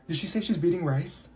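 ordinary talk, nothing marked

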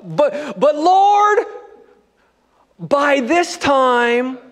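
high, drawn-out mock protest; hammed-up objection, pitch leaping up and words stretched; protesting Martha. Preacher voices Martha speaking up, high dramatic protest.

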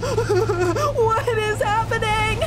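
slow bewildered shout, half crying; panicked laughing cry. Real, not acted. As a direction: stretch it out in disbelief. Boss fight chaos.

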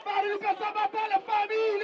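high shouted call to the crowd. Real, not acted. MC repeats after weak crowd response. (grito agudo cobrando barulho da plateia)

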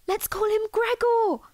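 a sudden happy idea; delighted gasp. Acted. Delighted gasp, naming roach.

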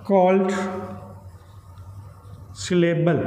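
very slow dictation, long pauses between chunks; slow word-by-word reading. Shown as a demonstration. Teacher reading a syllable definition to students, slowly word by word. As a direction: read it word by word, leaving long gaps.

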